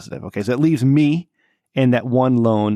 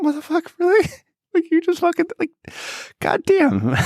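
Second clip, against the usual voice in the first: very high, laughing disbelief; high, laughing what-the-hell; disbelieving shriek. Retold or imitated. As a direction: squeal it out in shock. Hearing coworker tested positive.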